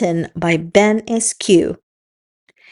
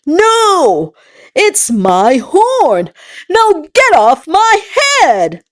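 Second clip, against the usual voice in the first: grumpy rhino voice; 8 semitones higher, slower than usual. Acted. Rhino answers monkey.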